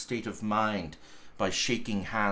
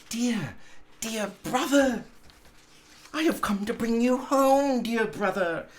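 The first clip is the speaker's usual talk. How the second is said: put-on high, light child's voice; a small girl pleading sweetly to her brother; little girl voice; 10 semitones higher than usual. A narrator's reading. Little sister welcoming her brother home, high childlike voice. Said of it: "said the little child".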